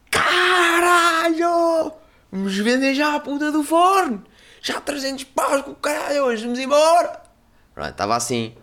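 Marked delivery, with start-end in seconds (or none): 0.1-7.2 much higher, loud, drawn-out yell of joy; excited kid shout. Retold or imitated. Thrilled kid shouting to his aunt, high and loud. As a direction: shriek it like a thrilled kid selling the oven. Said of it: "todo puto excitado".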